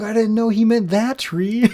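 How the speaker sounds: high, loud, drawn-out protest; imitated wife's excuse. Wife to husband about the tree, high mock protest. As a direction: plead innocence in a hammed-up high voice.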